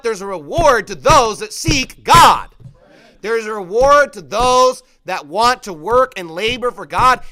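loud, emphatic pulpit proclamation; ringing sermon delivery; full-force preaching. Real, not acted. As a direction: drive the promise home to the congregation, loudly.

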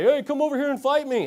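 mock a heckler picking a fight; taunting challenger. Acting out freaks picking a fight.

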